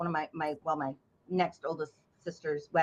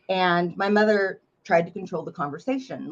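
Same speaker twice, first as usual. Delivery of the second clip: ordinary talk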